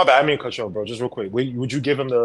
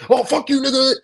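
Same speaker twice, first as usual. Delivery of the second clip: mock cursing caller; 9 semitones higher than usual. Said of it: "someone said, you know, curse him out".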